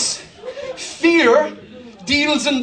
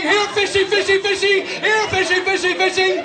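mock coaxing fish call; lightly sing-song here fishy call; teasing call to the sharks; 6 semitones higher than usual.

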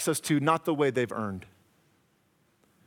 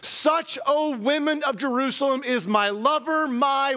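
building scripture reading; 6 semitones higher than usual. Acted. Reads Song of Songs, building.

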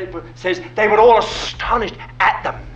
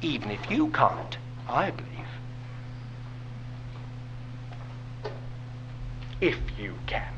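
gentle reassurance; 6 semitones lower, 12 dB quieter than usual. Retold or imitated. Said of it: "as if the Lord said".

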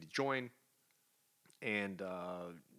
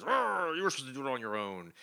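a growling, louder king who starts with a high snarl; angry king; 4 semitones higher, 5 dB louder than usual. Retold or imitated. The king turning on Namor, an angry mock growl. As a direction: bark it out like a betrayed king.

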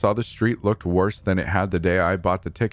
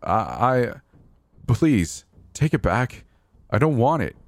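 high, anxious begging; pathetic pleading; 5 semitones higher than usual. A narrator's reading. Narrator begging the man to take the money back, pathetic pleading. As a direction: plead helplessly, pitched up and faltering on the "I". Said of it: "My words sounded pathetic to my own ears".

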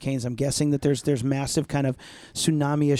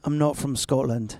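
put-on Scottish accent. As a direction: try a whole sentence in a Scottish accent. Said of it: "Put a whole sentence together".

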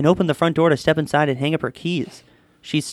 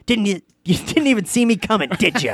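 higher, lightly hammed-up taunt; gloating magician; 5 semitones higher than usual. A narrator's reading. Magician popping up behind his girlfriend, mock gloating. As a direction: gloat about the trick in a raised voice.

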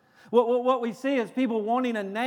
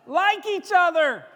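high, loud shouted order; scream; 10 semitones higher, 6 dB louder than usual. Acted. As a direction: yell it out as a demand. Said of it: "get up here and scream".

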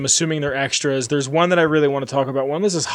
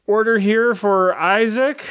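imitated counter worker's call, higher and drawn out; calling out an order; 6 semitones higher, slower than usual. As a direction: lightly mimic a slow order announcement.

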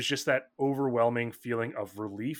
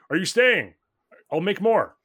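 higher, louder call from the kitchen; 8 semitones higher, 9 dB louder than usual. Acted. Dad calling from the kitchen to his guest, loud. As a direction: shout it over your shoulder cheerfully.

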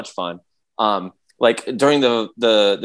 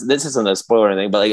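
ordinary talk, nothing marked

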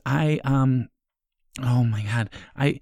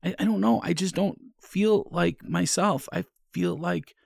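scared; 5 semitones higher, 4 dB quieter than usual. Acted. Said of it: "I'm a little scared".

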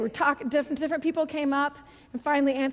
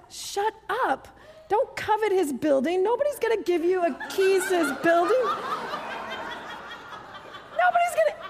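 laughing mock rebuke; 6 semitones higher than usual. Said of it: "I said".

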